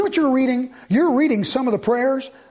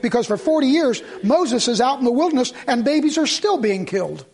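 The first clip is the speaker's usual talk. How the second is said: normal talking voice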